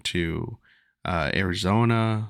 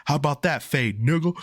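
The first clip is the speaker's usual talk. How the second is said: rising mocking taunt, higher at the end; mild imitation of a bully gloating; retold taunt; 6 semitones higher than usual. Bully gloating after the punch, mildly imitated, higher. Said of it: "just going crazy".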